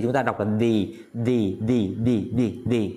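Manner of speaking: "the" said as "thee" repeatedly; teacher drilling "the" as "thee" before a vowel. Shown as a demonstration. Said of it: "khi cái chữ này mà đứng trước một cái từ bắt đầu bằng nguyên âm".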